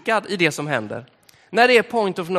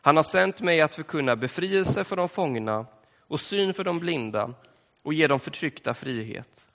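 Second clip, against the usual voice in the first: solemn reading from Luke; scripture reading; 3 semitones lower than usual. Acted. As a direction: read the Gospel passage to the congregation. Reads scripture aloud.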